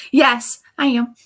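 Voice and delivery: loud high admission; laughing shout. Real, not acted. Admitting she is molting.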